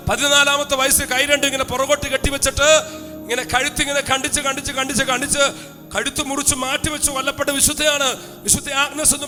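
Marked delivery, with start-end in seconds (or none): none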